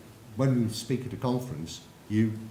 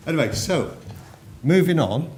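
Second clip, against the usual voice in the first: higher and louder, calling over the room; loud moving on; 6 semitones higher, 10 dB louder than usual. Real, not acted. Speaker to a laughing audience, loud call to move on. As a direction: raise the voice to pull the crowd back.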